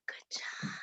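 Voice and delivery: hushed unvoiced whisper; whispered praise. Real, not acted. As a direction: whisper it, barely there. Praising children after their answer.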